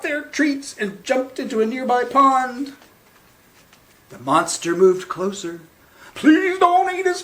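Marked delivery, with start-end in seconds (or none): 6.1-7.2 high, louder terrified begging; frightened plea. Acted. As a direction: beg for your life. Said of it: "cried the dogs".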